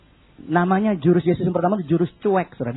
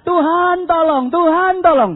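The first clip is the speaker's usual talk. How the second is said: high, slow wailed plea; mock desperate prayer; pleading prayer; 7 semitones higher, slower than usual. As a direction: call out high, stretching each word. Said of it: "udah doa".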